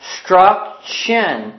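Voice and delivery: "Instruction" very slowly, syllable by syllable; sounding it out. Shown as a demonstration. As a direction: break "Instruction" into in, struc, tion. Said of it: "sound it out with me".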